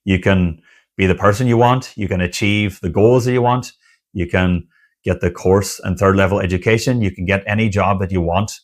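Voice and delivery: ordinary talk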